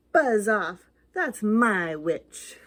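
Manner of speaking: loud, very slow monster voice; beast growl. Acted. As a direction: growl it out, claiming the witch. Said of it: "a yell and a growl".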